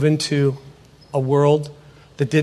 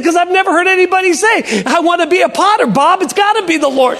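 high, animated pastor's argument; emphatic insistence; 12 semitones higher, 4 dB louder than usual. Retold or imitated. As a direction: insist excitedly, pitch held high. Pastor insists to doubting speaker.

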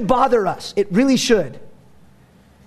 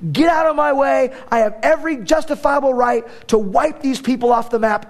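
angry God; 5 semitones higher than usual. Retold or imitated. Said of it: "He said".

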